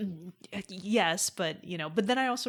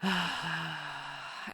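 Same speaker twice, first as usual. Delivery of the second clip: long sigh; 5 dB quieter than usual. Real, not acted. Co-host reacting to friend's shopping tale, long sigh.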